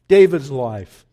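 normal talking voice

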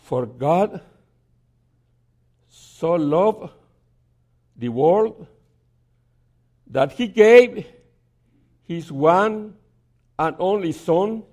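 slow reading with long pauses between phrases; very slowly. Retold or imitated. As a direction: read it word by word, very slowly. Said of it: "This time very slowly".

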